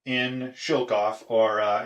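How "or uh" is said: ordinary talk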